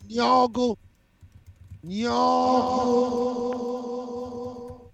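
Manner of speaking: silly high nyoggle. Acted. Silly vocal noise.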